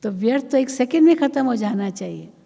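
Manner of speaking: high, fast, forceful; emphatic preaching. Real, not acted. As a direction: drive the point home with fervour. (ऊँचे स्वर में ज़ोरदार उपदेश)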